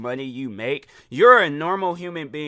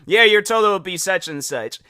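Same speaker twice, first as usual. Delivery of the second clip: loud, higher cashier announcement; pointedly raised counter voice; talking loud; 4 semitones higher, 6 dB louder than usual. Retold or imitated. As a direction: state it over someone's phone call. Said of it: "So I start talking loud".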